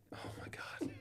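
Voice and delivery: unvoiced, breathless whisper. Muttered beside his scared wife at night, whispered. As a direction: barely breathe it out in alarm.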